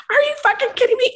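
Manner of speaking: high, fast incredulous retort; heated outburst. Retold or imitated. Retelling a fight with a server at work, heated. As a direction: fire it off high and quick, heated. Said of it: "I'm sorry, I get all heated".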